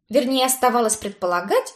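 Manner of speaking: usual speaking voice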